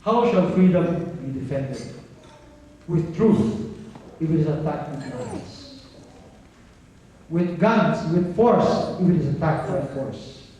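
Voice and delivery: emphatic, measured oratory with long pauses; solemn podium recitation; recited quote. Acted. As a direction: declaim it line by line, punching the key words. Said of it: "And it goes this way".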